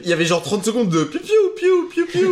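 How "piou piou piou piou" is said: high pitched pew pew noises; laser sound effect. Pretend. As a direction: imitate toy laser blasts. Imitating laser sounds from episode.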